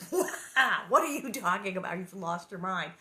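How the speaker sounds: loud start, falling to disbelief; mock incredulous parents. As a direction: react as a stunned parent, lightly acted. Parents' incredulous reply, acted.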